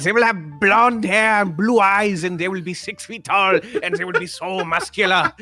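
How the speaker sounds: hammed-up ranting dictator; mock ranting dictator. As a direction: ham up a dictator's rant. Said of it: "the mustache man ranted about".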